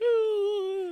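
high, loud mock bawling; crying little girl. Teasing a friend over his bar meltdown, mock little-girl crying. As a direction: wail it tearfully, hammed-up and laughing. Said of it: "stomped around like a little girl".

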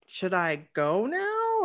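slow, stretched, rising question; tentative question. Retold or imitated. Guest fearing she's overstaying, tentative. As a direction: ask awkwardly, testing whether to leave. Afraid of overstaying, asks to leave.

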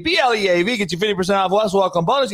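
usual speaking voice

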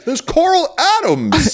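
high, slow drawn-out intro; mock announcer. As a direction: stretch the name like a ring MC.

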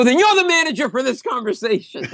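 very high indignant retort; hammed-up outraged customer; irate customer. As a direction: overrule the worker in mock fury. Acted complaint to counter worker.

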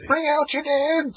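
high, slow calling out bring out your dead; Monty Python cart man call. Retold or imitated. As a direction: bellow it like a plague cart man. Monty Python quote called out.

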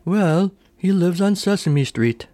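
high, lofty imitation of a haughty bird lady; a grand bird chairwoman addressing the board; regal bird voice. Regal bird chairwoman reminding the board of their purpose, high and lofty. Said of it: "very regal sounding bird says".

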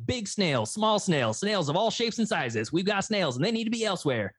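high, bright huckster pitch, selling hard; radio spot read high and eager; hammed-up radio ad. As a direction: play a cheesy local ad announcer pushing free snails. Mock radio ad for snails.

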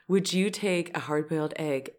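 soft, low-key retold proposal; quietly posed question. Wife texting her husband an odd request, quietly posed. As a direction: pop the question softly.

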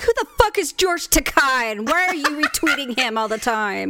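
loud, high mock-jealous outburst; Victor. Jealous partner objecting to her retweets, mock outrage. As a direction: imitate an outraged partner demanding an explanation. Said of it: "If Victor did that to me, he'd be like".

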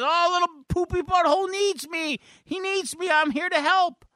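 very high acted squeal; hammed-up eager teen; excited. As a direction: gush it high, thrilled to be needed. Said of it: "Summer's excited".

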